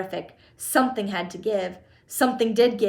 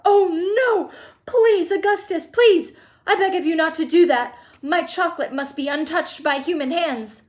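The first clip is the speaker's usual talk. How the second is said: high, loud pleading; crying out; 7 semitones higher, 6 dB louder than usual. A narrator's reading. Chocolate maker begging boy not to touch, crying out. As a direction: beg in high-pitched alarm. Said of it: "he cried out".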